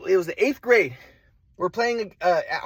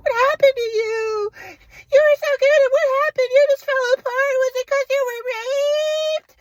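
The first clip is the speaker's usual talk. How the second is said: much higher, pleading mom caricature; mocking mom voice. Son mimics mom begging to know, high mocking voice. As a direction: push it up high and needy like his mom.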